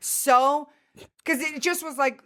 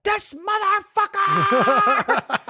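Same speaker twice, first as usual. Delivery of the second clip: high, drawn-out mock howl of outrage; comically furious, long high-pitched cry; outraged outburst; 11 semitones higher, much slower than usual. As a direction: howl it slowly, every syllable stretched out high. Acted outburst at the test result.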